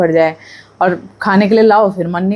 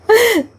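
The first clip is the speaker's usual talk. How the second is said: a quick, very high burst of laughter; short laugh; 14 semitones higher than usual. Real, not acted. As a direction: snort out a laugh. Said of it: "मेरे को भी हंसी आ रही है".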